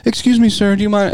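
high sugary polite request; mock polite voice; overly polite stranger. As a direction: ask sweet as pie. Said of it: "polite with".

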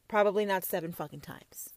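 unvoiced whisper. Real, not acted. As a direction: whisper it as an aside. Aside whisper.

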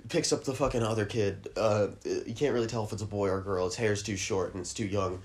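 ordinary talk

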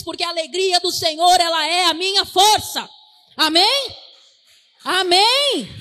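loud, driving sermon declaration; full-force preaching. Acted. Preacher urging the congregation to declare joy, loud and driving. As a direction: proclaim it loud to lift the room.

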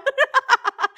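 squealing laugh; high laugh burst. Real, not acted.

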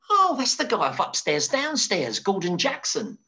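high, mock-excited assistant; excited recognition. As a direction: exclaim it like someone spotting a TV star.

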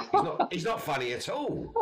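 high-pitched laughing denial; laughing protest. Retold or imitated. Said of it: "And I went".

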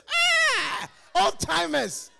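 put-on high excited call to old friends; loud greeting. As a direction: hail them loudly. Greeting old members loudly.

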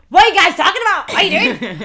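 loud high mock-puzzled voice, fast, laughing at the end; imitating a confused friend. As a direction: burst in loud and bewildered. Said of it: "Kerry's is like".

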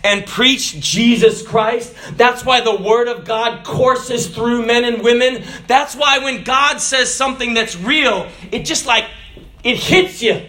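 drawn-out, driving pulpit delivery; forceful preaching. Acted. Preacher declares why the word of God is preached, forceful preaching. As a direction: preach it out with full conviction.

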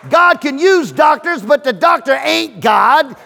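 loud, high sermon shout; fiery preacher building the point; preaching at full force. Acted. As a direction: hammer it home to the congregation.

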